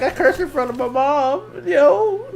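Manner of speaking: can't believe what just happened; giddy disbelief. Real, not acted. Teen telling listener he cursed before mom, giddy disbelief. Reliving disbelief after cursing.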